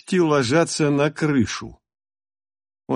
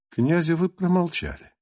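plain reading voice

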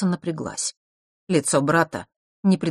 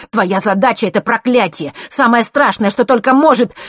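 loud outburst, climbing higher; spat out; 8 semitones higher, 9 dB louder than usual. A narrator's reading. Nort blurts out the curse to his sister, angrily. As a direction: blurt it out in rising anger. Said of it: "словно выплюнул".

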